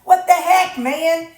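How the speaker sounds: high, loud mock outburst at the sellers; mock outraged rant. Imagining the painter fooled by orange bleed, mock outrage. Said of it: "I'd be like".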